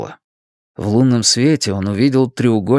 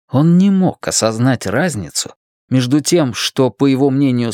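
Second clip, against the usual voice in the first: ordinary delivery, nothing marked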